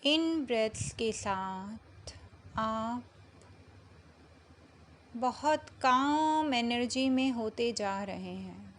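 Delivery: slow meditation voice with long pauses between phrases; unhurried, measured meditation guidance; guided meditation. Acted. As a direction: guide them gently into calm, drawing out the words, phrase by phrase.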